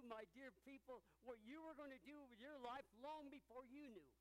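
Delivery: preaching. Acted. Pastor lifting the congregation, preaching at full force. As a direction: rise up and press each line home.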